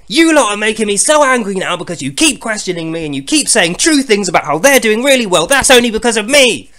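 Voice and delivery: high, loud scolding rant; mock angry god scolding his followers. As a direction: berate the faithful, fuming. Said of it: "He's, like, angry".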